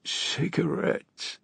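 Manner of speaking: high, slow hoarse croak; hoarse jackdaw voice. A narrator's reading. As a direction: rasp it out like a jackdaw. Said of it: "in that hoarse jackdaw voice".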